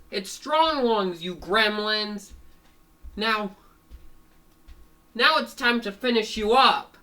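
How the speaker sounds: high, loud cartoon fighter; taunting threat. Fango about to finish off his opponents, taunting threat.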